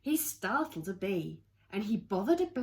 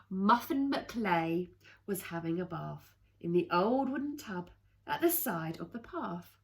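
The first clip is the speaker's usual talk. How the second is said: usual speaking voice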